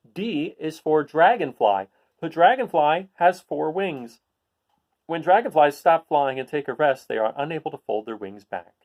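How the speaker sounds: bright, clear picture-book read-aloud; reading to children. Acted. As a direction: read it out to a class of kids.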